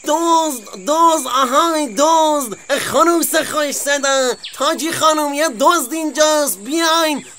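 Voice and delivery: high, calling out thief across the farm; alarm call. Acted. Fox calling the rooster and hen to catch the weasel, high alarm cry. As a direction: raise the alarm, wake everyone.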